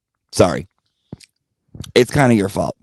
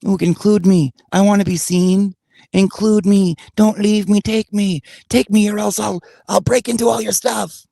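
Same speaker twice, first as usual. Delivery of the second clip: higher, pleading mock voice of a crazy ex-girlfriend; hammed-up desperate ex, pitched up; mocking clingy ex; 8 semitones higher than usual. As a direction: whine it like a needy ex begging to come along. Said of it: "Crazy ex-girlfriend, right?".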